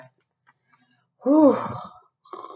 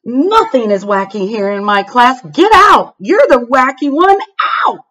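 angry teacher; 4 semitones higher, 6 dB louder than usual. Acted. Miss Beth ordering the child out, angry. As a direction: Get out rises much higher and louder.

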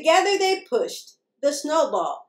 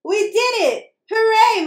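high, loud triumphant cheer; 9 semitones higher, 6 dB louder than usual. A narrator's reading. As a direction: cheer in celebration. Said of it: "Molly cheered".

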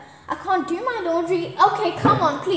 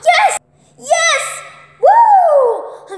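excited cheer; 12 semitones higher, 10 dB louder, much slower than usual. Real, not acted. Caught a fish, cheering.

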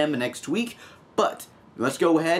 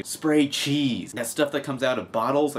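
ordinary delivery

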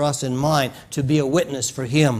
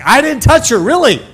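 defensive protest; 11 semitones higher, 7 dB louder than usual. Retold or imitated. Embarrassed, leaning away defensively.

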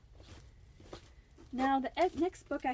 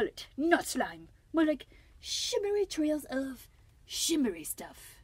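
louder bad French accent, grandly offended; Escargot; 4 semitones higher, 8 dB louder than usual. French snail defending his trails against being called slimy, very bad French accent. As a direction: protest with flourish in a hammed-up French accent. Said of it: "a very, very bad French accent".

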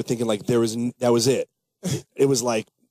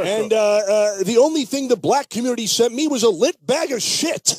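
Rush Limbaugh impression; 8 semitones higher than usual. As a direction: stammer and bluster like a pompous radio pundit. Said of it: "What would Rush Limbaugh say about that?".

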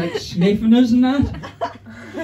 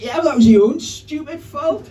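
petulant retort; 8 semitones higher than usual. Retold or imitated. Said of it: "I was like".

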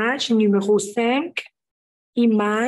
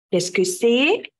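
normal talking voice

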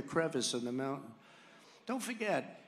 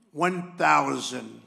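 computer altitude callout; 8 dB louder, much slower than usual. Retold or imitated. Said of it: "It's an incredible voice, a computer voice".

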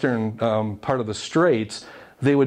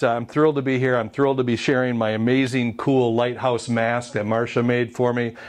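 ordinary delivery

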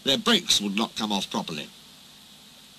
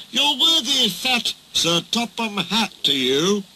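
ordering; 9 semitones higher than usual. A narrator's reading. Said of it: "ordered Duck".

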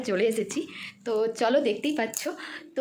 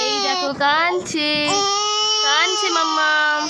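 slow, stretched, loud commentary; slow loud sing-out over wailing; drawn-out teasing; 4 semitones higher, 4 dB louder, much slower than usual. Real, not acted. Talking over her crying child.

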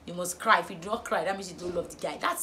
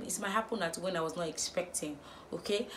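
ordinary talk, nothing marked

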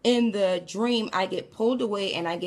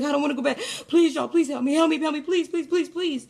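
high, urgent, piled-up begging; imitated screaming plea; 7 semitones higher, 3 dB louder, faster than usual. Tormented boy begging not to return to hell, frantic screaming. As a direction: act out terror, repeating without let-up. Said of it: "the way he was screaming along".

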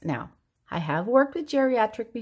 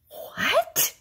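put-on much higher, puzzled old dad's "What?"; hard-of-hearing dad; 13 semitones higher than usual. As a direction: play the deaf old father straining to hear. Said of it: "This is my dad".

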